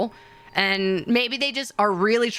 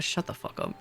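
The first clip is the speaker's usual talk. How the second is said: whispered; 12 dB quieter than usual. Real, not acted. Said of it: "Very angry a little bit".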